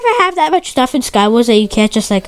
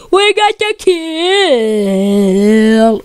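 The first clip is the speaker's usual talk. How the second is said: drawn-out victory call; 3 semitones lower, much slower than usual. Acted.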